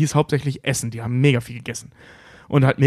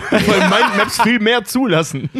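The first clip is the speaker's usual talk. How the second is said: laughing; 4 semitones higher, slower than usual. Real, not acted. Laughing during banter.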